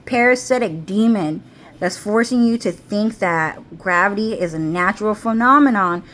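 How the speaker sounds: normal talking voice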